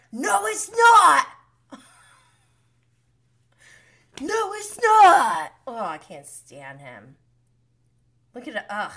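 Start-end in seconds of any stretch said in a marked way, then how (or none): 0.1-1.3 loud, high, drawn-out mimicked yell; mock yelling. Imitating him as he yells at her, mock yell. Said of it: "he yells at her". 4.2-5.5 loud, high, drawn-out mimicked yell; mock yelling. Imitating him as he yells at her, mock yell. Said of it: "he yells at her".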